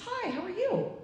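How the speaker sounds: high chirpy hello to a stranger; friendly high greeting. Retold or imitated.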